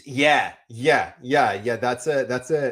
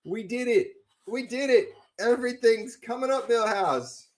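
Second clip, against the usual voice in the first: excited; 9 semitones higher, 4 dB louder than usual. Real, not acted. Food delivery arrives, thrilled.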